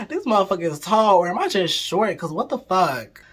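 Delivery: giggly incredulous rant; laughing disbelief. Real, not acted.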